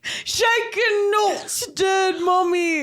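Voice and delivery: very high, hammed-up mummy's boy; Max impression. Son waking at night to Mummy, high put-on cry. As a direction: cry it out like a mock sleep-talker. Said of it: "He wakes up in the middle of the night".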